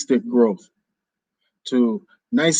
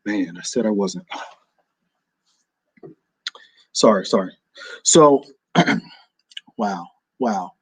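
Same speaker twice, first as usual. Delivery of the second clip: choking up; 4 semitones lower than usual. Real, not acted. Host saying goodbye to listeners, choking up. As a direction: fight back emotion.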